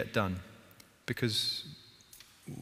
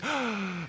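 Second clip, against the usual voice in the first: mock gasp of panic; 9 semitones higher, 3 dB quieter than usual. Martha remembers pudding.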